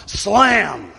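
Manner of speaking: a loud spoken slam; door slam. Acted. Sulky child slams the door in the skit. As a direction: bang the door shut with your voice.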